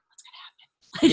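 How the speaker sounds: imitated hushed audience whisper; mock-anxious stage whisper; suspenseful whisper. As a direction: whisper it like an onlooker holding their breath. Hushed room awaiting the outcome.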